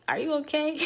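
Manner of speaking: Owen checking in. Retold or imitated. Guide turns around to the crying hiker, mild mock concern. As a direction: imitate a concerned guide checking on her, lightly. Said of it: "and he's like".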